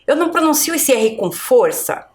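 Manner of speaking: normal talking voice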